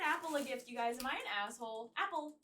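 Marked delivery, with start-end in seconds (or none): none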